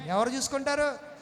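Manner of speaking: ordinary talk, nothing marked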